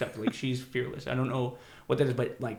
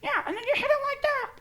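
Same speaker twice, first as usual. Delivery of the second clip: very high squeaky kid imitation; squeaky teen voice. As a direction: mimic a squeaky kid showing how it's done. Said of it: "the squeaky voice".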